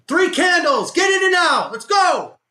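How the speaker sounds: real high, loud shout at the trade; frustrated full-voice shouting; urgent shouting. Real, not acted. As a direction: yell it like you are willing the market to move. Urging himself on during trade.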